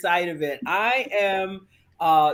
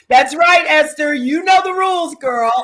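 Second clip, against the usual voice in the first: loud, much higher playful shout; excited shout; 8 semitones higher, 9 dB louder than usual. Real, not acted. Guest cheering the host's show-must-go-on line, loud and high. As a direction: whoop it at her like a cheer.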